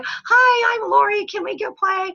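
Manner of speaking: high, hammed-up sandbox kid; child at sandbox. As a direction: greet a new friend like a small child. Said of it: "we go to the sandbox, we go".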